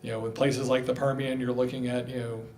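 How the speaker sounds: usual speaking voice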